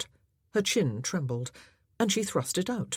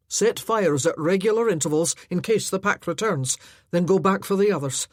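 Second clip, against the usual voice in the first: louder commanding voice, faster at the end; laird giving orders; 2 semitones higher, 6 dB louder than usual. Acted. Laird ordering his men to make camp, firm.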